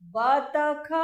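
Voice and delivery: बतख in three even, emphatic syllables; sounding out. Shown as a demonstration. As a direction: join the letters of बतख with stretched vowels. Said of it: "ये देखिए क्या बनी हुई है".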